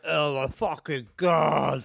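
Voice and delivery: slow, drawn-out pained oath; retelling the toothache as the numbness wears off; pained groan. Retold or imitated. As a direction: drag it out as the pain hits. Anaesthetic wearing off, pain.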